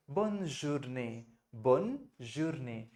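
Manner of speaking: Bonne journée drawn out slowly, syllable by syllable; slow French model phrase. Shown as a demonstration. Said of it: "तो आप उसको बहुत simply कहेंगे". (धीरे-धीरे French उच्चारण)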